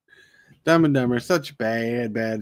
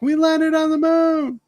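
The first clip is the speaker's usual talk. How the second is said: much higher, slow and thrilled; excited. Retold or imitated. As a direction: announce it in high wonder. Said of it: "he got excited and he's like".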